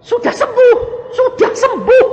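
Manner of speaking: high, loud triumphant cry; exultant shout. Real, not acted. Preacher to congregation, shouting joyfully. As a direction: proclaim the healing in triumph.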